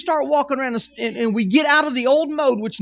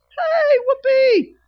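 very high, loud, slow whoopee; mock wife's cheer; 6 dB louder, much slower than usual. Pastor imitating his wife thrilled about dinner, squealing high.

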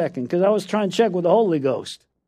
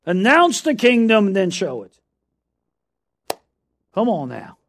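preaching; 4 semitones higher, 5 dB louder than usual. Acted. Sermon opening exhortation.

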